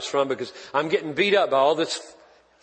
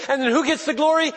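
higher, louder, slowed-down pulpit delivery; preaching at full force; 10 semitones higher, 4 dB louder than usual. Acted. Pastor pressing the crowd on verse sixteen, preaching at full force. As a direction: drive each phrase home to the congregation.

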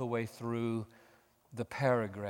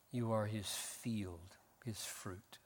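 soft slow close; 3 semitones lower, 9 dB quieter, slower than usual. Acted. Preacher closing the point softly.

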